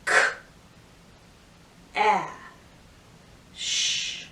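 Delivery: each sound slowly apart. Shown as a demonstration. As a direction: split cash into single sounds. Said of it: "teacher sounds out cash".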